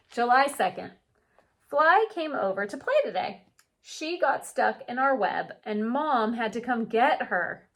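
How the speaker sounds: gentle storybook read-aloud; unhurried picture book narration; reading to children. A narrator's reading. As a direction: read it like story time for kids.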